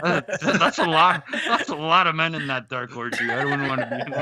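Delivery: talking through real laughter; laughing. Real, not acted. Co-host reacts to the orgy joke, laughing. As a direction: crack up while getting the words out.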